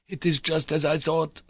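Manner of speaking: explaining to his nephew, a lightly voiced uncle. A narrator's reading.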